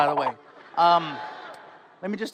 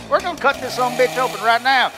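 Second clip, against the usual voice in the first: higher, louder, fast rowdy doctor; brash American surgeon; 6 semitones higher, 6 dB louder, faster than usual. Retold or imitated. Surgeon telling a heart patient the plan, rowdy and loud. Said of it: "Which doctor do you want?".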